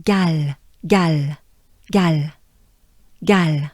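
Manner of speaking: French Galles, open A, swallowed final S; French pronunciation. Shown as a demonstration. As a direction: model Galles as a French teacher. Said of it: "Saint-Romain-en-Galles".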